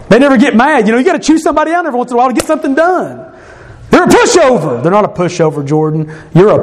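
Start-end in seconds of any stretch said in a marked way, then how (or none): 0.1-3.3 high, fast, dismissive griping; mock exasperation, pitched up; scornful old thought. Acts out his old judgmental thought. 3.9-4.8 high-pitched, mocking put-down; acted contempt, pitched up; scornful dismissal. Old judgment, then corrected.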